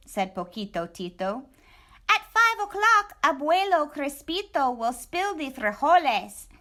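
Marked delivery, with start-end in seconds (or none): 2.1-6.5 much higher chirpy chihuahua voice; a tiny excited dog greeting his friend; squeaky little dog. Acted. Poquito Tito greeting Scapito, high squeaky voice.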